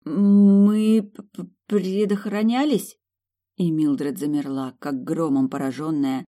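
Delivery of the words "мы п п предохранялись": embarrassed, stuttering high question; stammering. A narrator's reading. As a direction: stumble over the word, flustered.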